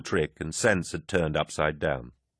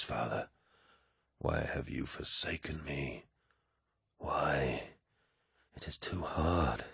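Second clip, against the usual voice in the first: much softer, lower, despairing hush; falling to a whisper; 5 semitones lower, 9 dB quieter than usual. A narrator's reading. Horus begs his absent father, hushed and low. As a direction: barely breathe the words to an absent father. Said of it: "his voice fell to a whisper".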